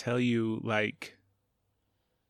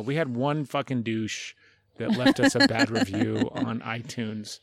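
usual speaking voice

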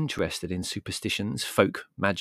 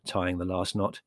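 plain reading voice

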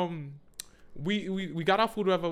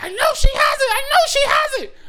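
very high screamed imitation of a kid; losing it; 10 dB louder than usual. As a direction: mimic a child's shrieking meltdown. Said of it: "Sugar start losing it".

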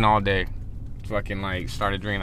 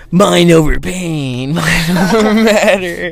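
slow, higher, louder mock mystic chant; slow, louder mock David Blaine mantra; dramatic magic mantra; 6 semitones higher, 6 dB louder, slower than usual. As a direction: ham up a magician's mind-over-matter line, slowly, with a laugh. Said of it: "And all of it's like".

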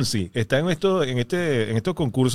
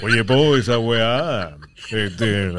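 lower-pitched Chilean broadcaster attempt; announcer voice; 7 semitones lower, much slower than usual. Comedian failing at the announcer request, mock announcer voice. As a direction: try a Chilean accent in a locutor register. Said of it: "pero con voz de locutor".

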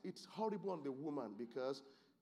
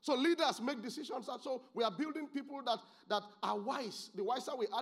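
high, raised preaching voice; impassioned preaching; 8 semitones higher, 6 dB louder than usual. Real, not acted. Preacher urging leaders to build wise people, impassioned. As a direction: drive it home to the congregation, pitched high.

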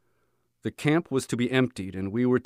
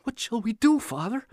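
desperate plea; 13 semitones higher than usual. A narrator's reading. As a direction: press him again, desperate. Repeated plea to silent father.

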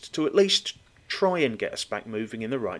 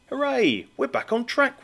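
mock cheer; 11 semitones higher than usual. Mock cheer, thought voiced.

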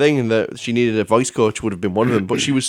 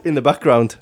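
ordinary talk

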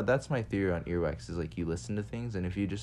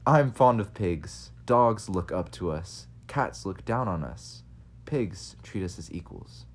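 slow, grand reading of a quote; slow, stately quote for the podcast opener; slower than usual. Acted. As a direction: read it slowly like a famous statesman. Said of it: "I can't do it in English accent".

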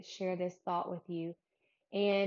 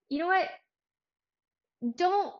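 high, loud and emphatic; bold interjection; 10 semitones higher, 8 dB louder than usual. Retold or imitated. Podcaster voicing David volunteering to fight Goliath, bold and emphatic. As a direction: cut in boldly, pitched up and punchy.

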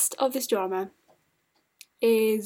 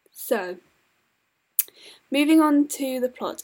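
dry mouth, lips smacking; 3 semitones higher, 6 dB louder than usual. Real, not acted. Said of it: "I'm sorry for my lip smacking".

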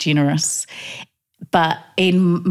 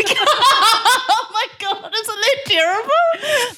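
much higher, slow, laughing mock horror; slower than usual. Mother mocking her own parenting, laughing high voice. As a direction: laugh through it, hamming up self-reproach.

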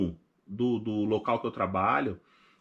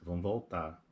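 much softer, low and heavy; quiet weary plea; 7 semitones lower, 9 dB quieter than usual. Retold or imitated. To his wife in bed, wanting to go home, very soft and low. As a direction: say it almost under your breath, defeated.

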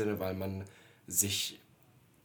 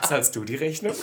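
teasing laugh; 5 semitones higher, 6 dB louder than usual. Pretend. Repeats the joke question.

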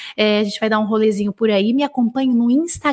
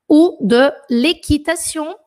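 slow, louder dictation of a French phrase; slow dictation read; 4 semitones higher, 4 dB louder, slower than usual. Shown as a demonstration. Teacher dictating slowly to students. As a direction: read it out slowly for the class to write down. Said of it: "dictation read".

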